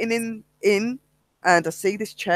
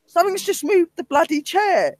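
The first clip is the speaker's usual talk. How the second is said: panicked insisting; 12 semitones higher than usual. Retold or imitated. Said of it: "I said".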